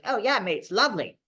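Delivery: lightly imitated Aussie, pleased with himself; a hint of a carefree Australian accent; Australian mate. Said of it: "he was Australian".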